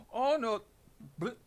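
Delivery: suddenly remember the trial; alarmed cry. Acted. Lawyer realizes he is late for court, alarmed cry.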